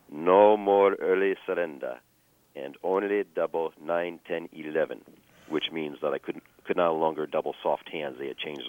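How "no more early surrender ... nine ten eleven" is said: slow, deliberate imitation of accented English; halting foreign English. Pit boss changing the rules on a player, halting accented English. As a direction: announce it word by word in laboured foreign English. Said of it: "in his best English".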